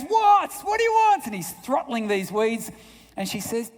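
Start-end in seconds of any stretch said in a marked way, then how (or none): 0.0-1.3 loud, very high mock yell; exasperated father. Grumpy dad finally turning on his daughter, exasperated shout. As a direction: explode at a child who keeps interrupting.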